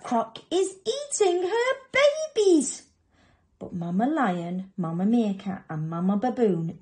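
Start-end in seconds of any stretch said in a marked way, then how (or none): 0.0-2.8 put-on high, loud child's alarm; horrified squeal. Little animals see crocodile and babies, horrified squeal. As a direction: squeal it in shock.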